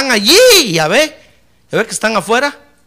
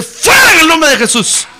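loud, higher commanding rebuke; rebuking shout; 7 semitones higher, 5 dB louder than usual. Acted. Preacher rebukes the enemy before the congregation, shouting. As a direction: cast the demon out with a shout.

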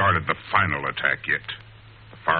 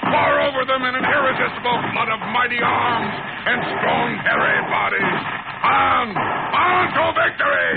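high, loud, exultant shouting building to a cry; villain's rallying shout; 4 dB louder than usual. Acted. Villain urges his cavemen to attack, exultant shouting. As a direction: whip the unseen tribe into a frenzy from afar.

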